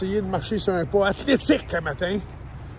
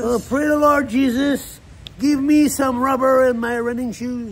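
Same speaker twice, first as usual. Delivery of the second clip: hammed-up gospel preacher, louder; mock preacher; 3 semitones higher, 4 dB louder than usual. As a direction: preach it like a revival pastor. Mock prayer to Jesus.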